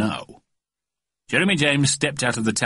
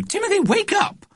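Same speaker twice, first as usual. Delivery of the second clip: very high, piercing wake-up call; mother's urgent, squeaky-high call; insistent mother. Acted. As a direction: pitch it right up to rouse him. Mother insists son wakes.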